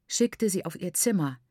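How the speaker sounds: plain reading voice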